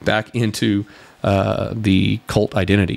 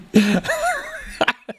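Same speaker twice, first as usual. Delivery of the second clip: high squealing laugh; 3 dB quieter than usual. Real, not acted. Laughs at own joke.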